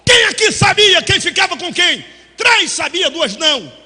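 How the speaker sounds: preacher firing at the church; preaching loud. Acted. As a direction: hammer it to the congregation. Preacher asks the crowd, loud.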